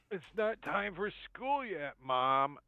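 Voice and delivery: high-pitched, whiny put-on child; sleepy schoolchild. Sleeping character mistakes waker for Mom, childish protest. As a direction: protest like a kid being woken. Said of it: "puts his hand on the dude's foot and says".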